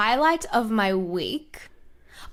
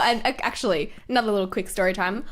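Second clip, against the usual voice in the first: normal talking voice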